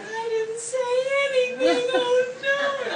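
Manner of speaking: very high, drawn-out mock crying; retold scared wail. Girl denying it to the audience, mock tearful wail. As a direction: whine it loud and slow, like a child in trouble. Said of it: "I was so scared".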